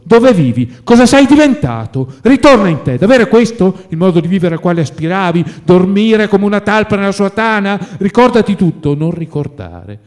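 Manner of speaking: loud, high-pitched scolding; heated reproach. A narrator's reading. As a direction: berate a friend who has let himself go. Friend reproaching him in a quarrel.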